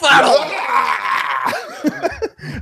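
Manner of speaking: high shouted laugh. Real, not acted. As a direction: burst out laughing. Laughing at being teased.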